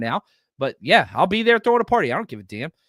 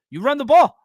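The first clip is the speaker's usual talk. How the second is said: louder and higher, hammering the point home; emphatic shout; 9 semitones higher, 7 dB louder than usual. Real, not acted. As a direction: shout it like the obvious answer. Repeating his point for emphasis.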